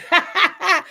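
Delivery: a sudden high, loud laugh; real laugh, high and loud; burst of laughter. Real, not acted. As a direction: crack up out loud at the comment. Laughing at a comment read out.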